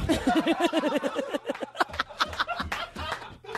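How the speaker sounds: high burst of laughter; big laugh. Real, not acted. Laughing at the friend's liver story, high.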